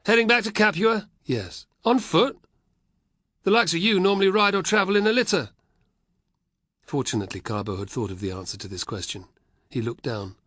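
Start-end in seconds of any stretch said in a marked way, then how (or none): 0.1-5.5 higher, louder soldier with a wink; teasing guard. Acted. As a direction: needle the boy from Capua.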